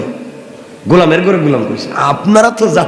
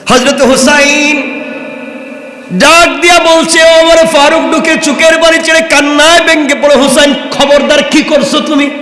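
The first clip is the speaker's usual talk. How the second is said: impassioned preaching; 9 semitones higher than usual. Acted. Preacher telling the story of Husain to the crowd, impassioned. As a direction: sweep the crowd along in full voice.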